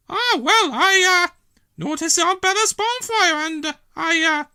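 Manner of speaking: put-on high old lady voice, hesitating and fumbling; embarrassed mumble. Dillis caught taking from Bella's bonfire, flustered. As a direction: caught out, stalling. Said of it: "Dillis mumbled".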